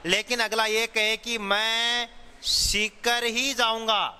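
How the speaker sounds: slow, with ही and Sikar hit firmly; stress on ही. Shown as a demonstration. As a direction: lean on Sikar ही, slowly. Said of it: "Sikar शब्द पर बल या जोर प्रदान किया गया है". (सीकर ही पर ज़ोर देकर, धीरे)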